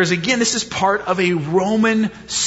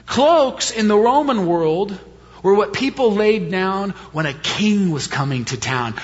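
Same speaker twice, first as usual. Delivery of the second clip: normal talking voice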